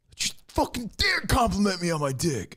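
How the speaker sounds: high, shouted mock outrage; hammed-up furious shout. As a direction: yell it like an offended rock star. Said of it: "he's like".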